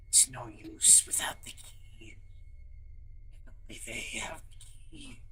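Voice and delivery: whispered insect voice with a lisp; bug voice with a lisp. Acted. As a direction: hiss it out like a weak bug creature. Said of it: "Imagine a bug voice with a lisp".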